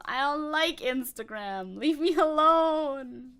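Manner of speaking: drawn-out, loud, high whine; hammed-up protest building to a wail; mock wail. Streamer objecting to Threads' Instagram link, mock wailing.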